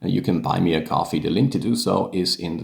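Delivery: brisk, hurried housekeeping aside; quick aside. Real, not acted. Podcaster asking viewers for likes and support, quick aside. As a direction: rattle through the channel plug. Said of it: "just one quick word".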